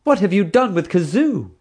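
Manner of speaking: high, loud demand. A narrator's reading. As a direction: demand it, indignant. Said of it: "she demanded".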